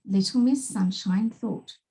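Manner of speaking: usual speaking voice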